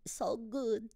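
put-on high-pitched English with a Québécois accent; small high voice, Québécois accent; French-accented English. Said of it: "j'ai un accent en anglais".

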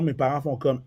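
usual speaking voice

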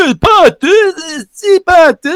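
high, halting mock stoner voice; mimicking the stoned guy. The speaker imitating the stoned driver's befuddled reply. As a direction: stammer it like a confused drunk.

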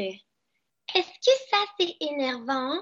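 put-on high, drawn-out little-girl voice; Agnès. Shown as a demonstration. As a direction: stretch it out in a tiny high-pitched kid voice. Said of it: "faire une imitation d'Agnès". (petite voix aiguë de fillette)

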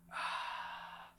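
long breathy "ah" after a sip; satisfied exhale. Real, not acted. Host opens his beer to start the show, refreshed exhale. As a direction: let out a voiceless, refreshed sigh.

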